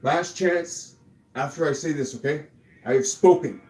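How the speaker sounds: loud, high, emphatic scolding; angry ultimatum. Real, not acted. Fed-up streamer warning Kathy over money and ego, angry ultimatum. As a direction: hit each word hard, fed up.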